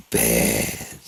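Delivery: "bad" dropped low, with force; menacing BAD. Shown as a demonstration. Speaker retries his threat to the doctor, menacing "bad". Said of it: "Hold on, let me get".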